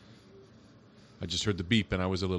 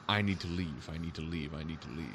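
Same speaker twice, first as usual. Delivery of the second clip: mock mutter, fading softer with each repeat; repeated mumble, sinking softer and softer; retold muttering; 3 semitones lower, 5 dB quieter than usual. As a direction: mutter it to yourself, trailing away. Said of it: "muttering to yourself".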